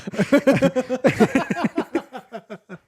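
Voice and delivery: long, loud high laughing fit; real high-pitched laughter. Real, not acted. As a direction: crack up helplessly, then tail off. Both laughing at the joke.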